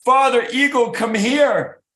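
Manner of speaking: high, loud call to Father Eagle; screaming mother eagle calling her mate; excited scream. Acted. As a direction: shout for him, thrilled. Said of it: "she started screaming".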